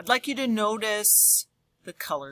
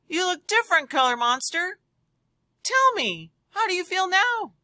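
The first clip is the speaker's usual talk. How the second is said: put-on much higher, louder girl's voice; excited girl character; 10 semitones higher, 3 dB louder than usual. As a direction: chirp it eagerly like a curious girl. Girl coaxes monster again.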